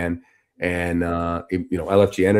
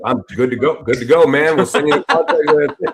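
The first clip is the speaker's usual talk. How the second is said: higher, louder, rushing to agree; eager agreement; 7 semitones higher, 7 dB louder than usual. Retold or imitated. The event team guy eagerly says yes to the deal. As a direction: sell it like a deal just closed.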